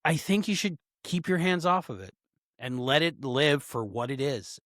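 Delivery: usual speaking voice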